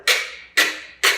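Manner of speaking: "K" as a voiceless click of air, not the letter name; short, sharp "K" bursts; isolated K sound. Shown as a demonstration. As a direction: pop out each "K" separately. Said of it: "Same thing with the K sound".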